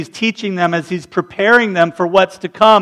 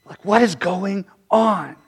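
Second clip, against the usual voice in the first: quiet musing; 7 dB quieter, slower than usual. Real, not acted. Preacher to his congregation, soft and slow. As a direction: let it sink in, nearly to yourself. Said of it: "We're like".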